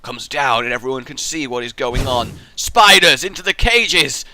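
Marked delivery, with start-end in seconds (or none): 2.5-4.2 loud, slow gloating order; villain command. Pretend. Boss commanding his minions, a loud slow order. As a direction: bellow it like an evil boss.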